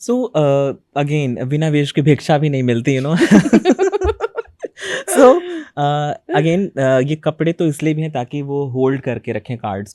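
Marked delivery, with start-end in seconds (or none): 3.3-4.4 high-pitched burst of giggling; high laugh. Real, not acted. Tarot reader amused by own saying about costume, high laugh. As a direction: laugh out loud, high and quick.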